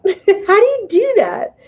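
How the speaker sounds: high, slow, laughing repeat of the question. Real, not acted. Repeats own question, then laughs.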